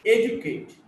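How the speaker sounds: ED in "educate" punched, then falling away; first-syllable stress. Shown as a demonstration. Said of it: "first syllable".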